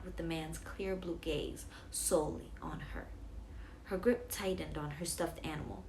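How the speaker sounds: plain reading voice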